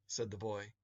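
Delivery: plain reading voice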